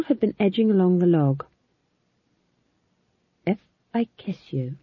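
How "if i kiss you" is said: softly careful, with hesitation; tentative, softened delivery. A narrator's reading. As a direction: feel your way cautiously into the question. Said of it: "he added carefully".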